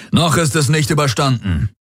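high, rallying cry; calling out. A narrator's reading. Field commander to his warriors, calling out. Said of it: "rief Daraios seinen Kriegern zu".

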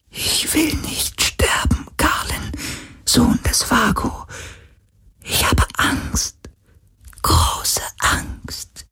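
high whisper breaking into a cry; a dying spirit begging in fear; frightened ghost whisper. Acted. Ghost tells Galen it fears death, tearful whisper.

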